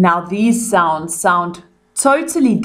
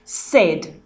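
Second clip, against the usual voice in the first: normal talking voice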